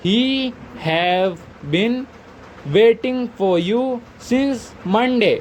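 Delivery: very slow, stretched words; drawn-out dictation, rising on each chunk. Shown as a demonstration. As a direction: say each word so the class can write it. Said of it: "teacher dictating finished sentence".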